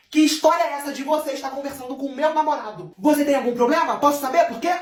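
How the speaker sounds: high-pitched, indignant grilling; angry confrontation. Acted. Nervous girl confronts Lívia about her boyfriend, indignant. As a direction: play the furious jealous girlfriend. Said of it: "essa menina nervosa chegou na mesa".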